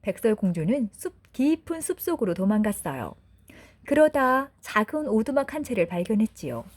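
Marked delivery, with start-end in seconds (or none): none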